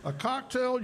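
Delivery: talking with a mouthful; words round a bite of food; mouth full. Real, not acted. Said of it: "he can't talk with his mouth full".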